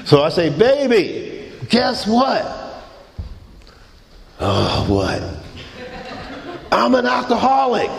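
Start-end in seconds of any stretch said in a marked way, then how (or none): 0.5-2.6 high, eager build-up to news; excited announcing. Retold or imitated. Husband phoning his wife with news, excited announcing. 4.4-5.2 low, resigned question; weary mock reluctance. Wife answering her husband's call, weary mock reluctance. 6.7-7.9 high, proud declaration; excited announcement. Retold or imitated. Husband telling his wife he's alcoholic, excited announcement.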